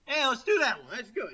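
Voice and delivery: high, loud, hasty pitch of an idea; hammed-up sudden enthusiasm; mock eager decision. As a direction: act out jumping on an idea. Acting out their hasty decision.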